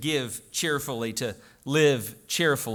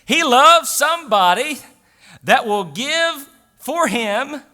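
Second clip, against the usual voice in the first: raised, higher preaching voice; full-force preaching; 7 semitones higher, 8 dB louder than usual. Real, not acted. Pastor urging cheerful giving from the heart, preaching hard. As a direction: ring it out to the congregation.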